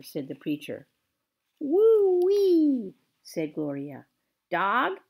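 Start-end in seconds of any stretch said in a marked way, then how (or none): 1.6-2.9 high, loud hoot; old woman exclaiming. A narrator's reading. Gloria whoops about the downpour, high and loud. 4.5-5.0 high parrot squeak. A narrator's reading. Gertrude the parrot squeaks a question, high. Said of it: "squeaked Gertrude".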